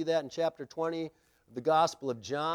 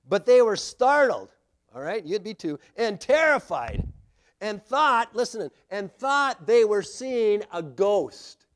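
louder and higher, the last line drawn out slowly; dramatic reading; 5 semitones higher, 7 dB louder than usual. Acted. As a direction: build the terror as you read. Reading the disciples' terror.

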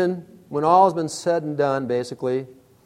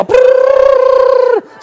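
loud, very high trilled "prrr"; imitated scattering noise; 13 dB louder than usual. Storyteller showing mice running all over, imitated trill. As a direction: trill loudly like scurrying mice.

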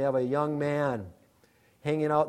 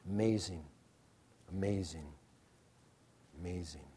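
fading away, much softer and lower each time; hushed awe; 11 semitones lower, 15 dB quieter than usual. Real, not acted. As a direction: let the awe drain into a hush. Awed fading repetition.

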